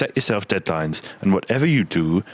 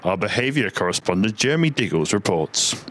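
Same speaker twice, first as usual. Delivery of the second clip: ordinary talk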